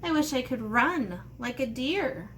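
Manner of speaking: normal talking voice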